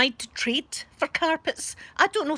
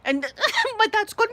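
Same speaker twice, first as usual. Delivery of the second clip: ordinary talk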